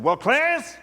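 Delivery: high, emphatic pulpit cadence; preacher speaking into the casket. Retold or imitated. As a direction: drive it home like a preacher.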